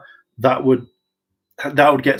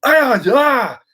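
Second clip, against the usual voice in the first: fighting grunts; 11 semitones higher, 5 dB louder than usual. Retold or imitated. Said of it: "you can only go".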